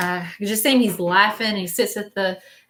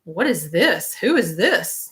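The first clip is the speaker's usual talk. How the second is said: swooping pitch on each short question; mock puzzled questioning, each word bent up and down; imitated doubtful viewers; slower than usual. Reader voicing newcomers to the stream, mock baffled. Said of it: "some of you got on here like".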